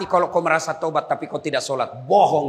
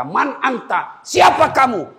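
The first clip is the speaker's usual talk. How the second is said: challenging demand; 10 semitones higher, 5 dB louder, slower than usual. Acted. Corpse challenges the tormentor.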